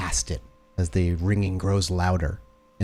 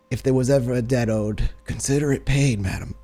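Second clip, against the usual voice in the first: higher, a lightly voiced loyal servant; 4 semitones higher than usual. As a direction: pay off the debt with dignity. [name] voiced, final line.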